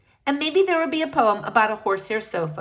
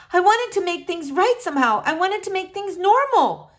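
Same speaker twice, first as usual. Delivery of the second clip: high, louder anxious mother voice; a frightened mother pouring it out; distraught mother; 10 semitones higher, 4 dB louder than usual. Acted. Mother telling Flora she searched her room, anxious.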